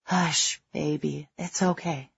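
soft, tender comforting; calm a frightened child softly; big soldier hushing a rescued child; soothing hush. A narrator's reading. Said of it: "making all the right noises".

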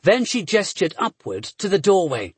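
usual speaking voice

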